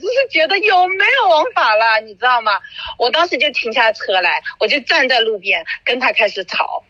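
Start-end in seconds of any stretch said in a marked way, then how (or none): 0.0-2.0 high, half-crying outrage; outraged. Real, not acted. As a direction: let the voice climb and crack with indignation. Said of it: "我当时就怒了". (愤愤不平，声音高且带哭腔)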